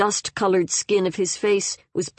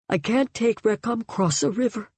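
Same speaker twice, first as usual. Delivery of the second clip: higher, hushed half-whisper; whispered worry; 5 semitones higher than usual. A narrator's reading. Frightened mother who can't take her baby across, hushed. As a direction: voice it small and high, a light stage whisper. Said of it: "she whispered".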